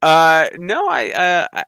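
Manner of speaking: ordinary talk, nothing marked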